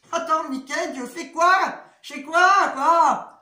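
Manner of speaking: panicked outburst. Retold or imitated. Alone with a blocked toilet before the weekend, panicked loud outburst. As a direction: relive the moment of panic about the weekend. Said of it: "Je me suis dit".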